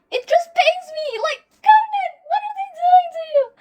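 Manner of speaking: very high, loud, tearful wail; crying out. Real, not acted. Fan crying out to Conan over his pain, high tearful wail. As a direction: plead with the character in anguish.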